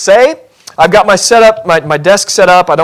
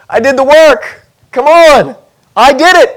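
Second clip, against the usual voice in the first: higher, pushy insistence; insisting on credit; 7 semitones higher, 4 dB louder than usual. Pretend. Abram insisting to God he did the work, high and pushy. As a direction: press God for credit like a sulky child.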